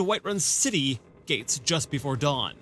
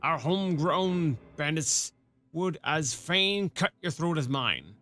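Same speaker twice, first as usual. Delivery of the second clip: put-on a rough rural-British-ish sergeant accent, loosely done; half-baked rustic soldier accent; gruff old soldier accent. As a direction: play the one-armed sergeant with a put-on country burr. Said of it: "My accent is terrible".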